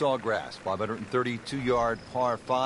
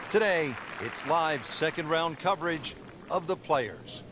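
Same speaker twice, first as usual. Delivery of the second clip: announcer voice; 5 semitones higher than usual. Acted. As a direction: open the show like a TV announcer. Broadcast intro announcement.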